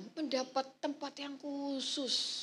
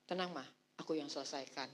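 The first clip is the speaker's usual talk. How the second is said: lower, softer, fast calm reassurance; reassuring husband; 11 semitones lower, 9 dB quieter, faster than usual. Retold or imitated. Husband calming wife when trouble comes, low soft reassurance. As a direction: settle her down, quick and low. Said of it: "Bapaknya maju".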